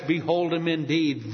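usual speaking voice

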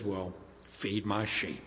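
low, soft and unhurried; gentle command. Retold or imitated. Voicing Jesus's gentle reply.

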